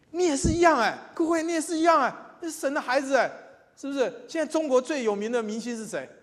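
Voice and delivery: loud, high exhortation at full force; preaching. Acted. Preacher urging the congregation to be bold, loud and high. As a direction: rouse them, call every listener out.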